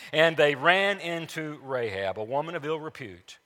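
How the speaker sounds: normal talking voice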